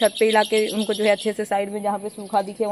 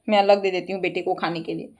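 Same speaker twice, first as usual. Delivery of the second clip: usual speaking voice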